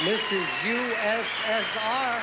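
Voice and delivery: high ringing pageant announcer's introduction; announcer call. Real, not acted. Host introducing the Soviet contestant to the audience, announcer call.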